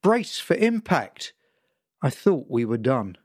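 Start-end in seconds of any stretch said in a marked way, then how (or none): 0.0-1.3 squealed warning. Acted. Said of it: "and Kara squealed".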